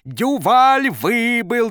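high, loud, drawn-out triumphant cry; jubilant coach. A narrator's reading. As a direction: crow it with glee. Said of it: "Тренер вдруг пустился в пляс".

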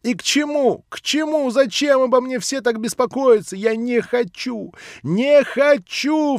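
high, loud, frantic shriek; frenzied cry. Acted. Nelly cries out in frenzy at everyone's concern, shrieking. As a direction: shriek it in a frenzy, slowing on не хочу. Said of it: "вскрикнула вдруг Нелли в каком-то иступлении".

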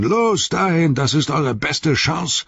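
high roared order; roaring. Acted. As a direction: drive the troop on with a yell. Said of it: "brüllte er".

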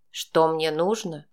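low, slow mocking repeat. A narrator's reading. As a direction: sneer it back slowly. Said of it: "издевательски повторил он".

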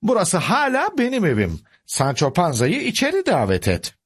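high-pitched firm protest; indignant cry. A narrator's reading. Uncle ordering Sancho be let in, indignant cry. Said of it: "diye bağırdı Don Kişot".

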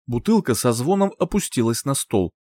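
ordinary delivery, nothing marked

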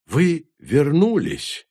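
протяжно и выше; восторженно тянуть слова; радостный возглас. A narrator's reading. Корневик радостно встречает. (корневик радуется возвращению друзей)

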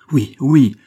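high, loud eager assent; servants' shout. A narrator's reading. Servants rally to the marquis's call, shouting. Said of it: "répondirent les domestiques".

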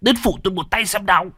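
urgent, rising to a high loud snap; old man barking at his wife to help; snapping. Acted. Husband snaps at his wife to help hold Kiều, angry shout. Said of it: "Nghe ông cả gắt lên".

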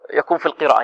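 ordinary delivery

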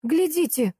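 high-pitched mock yell; excited yell. A narrator's reading. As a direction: cry out in alarm, without real volume. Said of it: "заорала я". (возбуждённый вскрик)

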